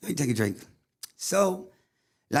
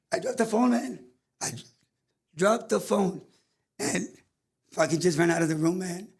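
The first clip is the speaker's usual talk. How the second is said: choked up; 4 semitones higher than usual. Real, not acted. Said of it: "I get very, ah, emotional".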